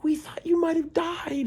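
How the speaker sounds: put-on very high, slow, earnest girl voice; concerned hippie girl. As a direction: stretch it out high and wide-eyed. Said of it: "She's like".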